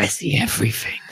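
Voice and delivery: raspy, breathy heavy smoker whisper; smoker's rasp. Acted. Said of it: "Why do you sound like a heavy chain smoker?".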